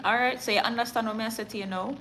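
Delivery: quick, playful Jamaican patois. Shown as a demonstration. Newsreader checks viewers follow her Jamaican patois. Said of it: "You understand patois?".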